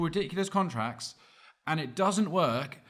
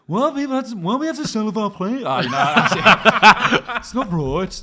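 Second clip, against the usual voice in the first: hammed-up Brummy accent, laughing; 3 semitones higher, 7 dB louder than usual. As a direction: whine it in a thick Brummy accent. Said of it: "Do your Brummy accent?".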